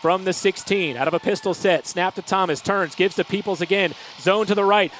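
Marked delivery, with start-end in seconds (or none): none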